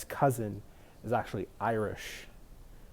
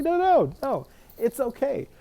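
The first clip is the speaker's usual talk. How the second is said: reassuring; 12 semitones higher, 5 dB louder than usual. Retold or imitated. Reassuring apologizing Americans.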